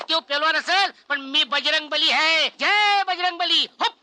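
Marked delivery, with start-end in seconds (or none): 2.6-3.9 drawn-out, higher devotional cry; boastful invocation. Acted. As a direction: proclaim it slowly like a war cry. Boastfully invoking the strongman god.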